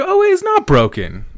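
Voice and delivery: high squeaky oh, wait, it's not broken; sudden high realisation; mock surprise. Noticing the leash is fine, high surprised squeak. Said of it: "I was like".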